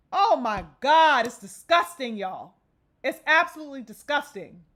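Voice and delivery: usual speaking voice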